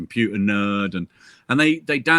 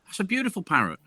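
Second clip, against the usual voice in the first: higher, amazed at a bargain; surprised customer; 9 semitones higher than usual. Acted. Customer reacting to the parrot's price, surprised. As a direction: act out a surprised shopper, pitched up. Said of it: "And she says".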